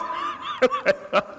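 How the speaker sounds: high burst of laughter; real laugh at the absurdity; laughter breaking out, soft. Real, not acted. Laughs at own joke.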